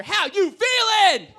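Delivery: slow, drawn-out crowd roar; bigger hype shout. Real, not acted. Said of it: "You guys can do way better".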